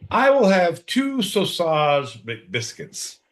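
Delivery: mock customer order. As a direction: play a silly customer ordering breakfast. Mock ordering sausage biscuits.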